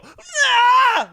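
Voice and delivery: sudden high screech of panic; mock scream. Retelling nearly getting hit, a high scream. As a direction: shriek like a startled man in traffic. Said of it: "like this".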